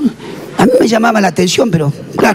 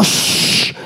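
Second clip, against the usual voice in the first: shushing; 4 dB quieter than usual. Acted. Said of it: "vino alguien y le dijo".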